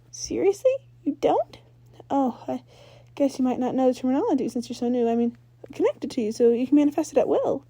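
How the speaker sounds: can't believe he doesn't know; incredulous Ember. Acted.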